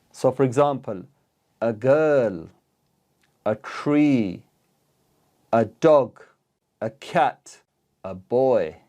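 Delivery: ordinary talk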